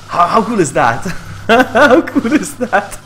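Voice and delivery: loud exclamation through laughter; delighted laughing. Real, not acted. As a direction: marvel at it, laughing. Delighted at the alert.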